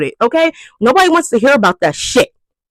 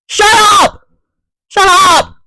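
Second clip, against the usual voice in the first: exasperated yell; 6 semitones higher than usual. Pretend. Yelling at loud phone talkers.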